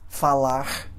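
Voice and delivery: "falar" ending in a throaty, breathed R like H; variant final R. Shown as a demonstration. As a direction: Brazilian "falah" ending on "falar". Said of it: "vários sons".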